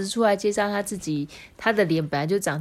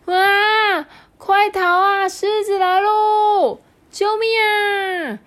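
much higher, loud playful screams; mock panic; 7 dB louder, much slower than usual. The friends running from the lion, squealing. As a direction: flee squealing in fake fright.

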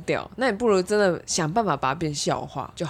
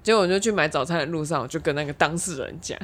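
usual speaking voice

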